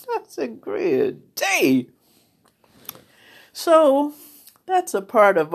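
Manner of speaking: tearful, slow and strained; real tears while speaking; crying. Real, not acted. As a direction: choke through the words. Tearful memory of shaving head.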